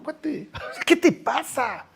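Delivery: burlón y lento, entre risas; regaño divertido entre risas; riendo. Acted. (Dios riéndose del humano)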